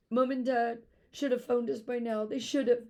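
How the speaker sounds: put-on a girl insisting her parents will come; insisting through sobs. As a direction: insist it while crying. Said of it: "she insisted through her sobs".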